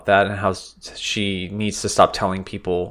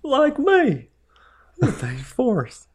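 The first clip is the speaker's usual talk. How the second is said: much higher mock Jenny voice, tender; Jenny impression; slower than usual. Quoting Forrest Gump scene.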